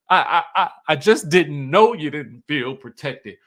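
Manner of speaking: quoting him with a laugh breaking through; giggly mock tremble; mock trembling through laughs. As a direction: laugh while pleading, half-mocking a shaky voice. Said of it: "his voice get to trembling".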